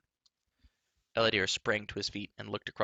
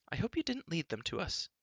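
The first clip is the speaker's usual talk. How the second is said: put-on much higher young girl, indignant; indignant young princess; girl's voice, crying out; 8 semitones higher than usual. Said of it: "Elenwy began".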